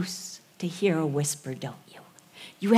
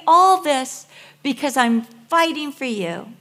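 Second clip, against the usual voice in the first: high, loud pleading complaint to God; impassioned Elijah; 9 semitones higher, 5 dB louder than usual. Acted. Elijah protesting to God, raised and pleading. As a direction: plead your case to God, indignant and hurt.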